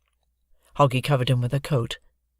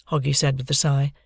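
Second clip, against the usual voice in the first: ordinary delivery, nothing marked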